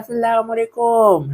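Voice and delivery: high, slow mock salam; imitated greeting. Imitating a greeting to Encang, high drawn-out call. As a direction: stretch the salam out high in a put-on voice. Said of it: "kalau ngomong Encang kan gini".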